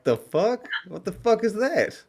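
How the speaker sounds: what the hell is that, high and drawn out; baffled outburst. Retold or imitated. Confused by the friend's water joke, high disbelieving outburst. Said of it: "and I'm like".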